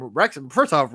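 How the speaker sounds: normal talking voice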